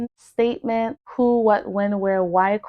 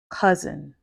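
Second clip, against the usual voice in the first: each word said alone, cleanly, with long gaps; teacher reading words for students to repeat; 4 semitones lower than usual. Shown as a demonstration. Reads schwa word list aloud.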